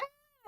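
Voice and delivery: tiny high squeal; soft, very high-pitched pretend shriek; mock scream. Clawed kids drop the cats, small mock scream.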